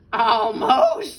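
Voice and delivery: high, loud, drawn-out reply; theatrical fox voice; scheming fox. A narrator's reading. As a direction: call it out as the showy fox. Fox answers the tired birds.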